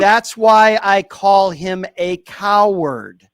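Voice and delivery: host pinning down the caller, slow and firm; slow, firm emphasis. Real, not acted. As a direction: spell it out slowly. Host pressing a caller hard.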